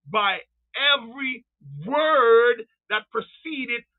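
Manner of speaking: slow, stretched-out preaching; emphatic scripture quoting. Retold or imitated. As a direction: draw out each word for the congregation. Preacher repeats verse for emphasis.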